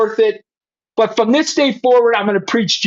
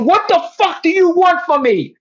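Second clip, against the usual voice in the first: reliving anger at God, pitched up; higher, heated demand without shouting; pissed off; 6 semitones higher than usual. Retold or imitated. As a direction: throw the angry question up at heaven. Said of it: "I could feel it right now".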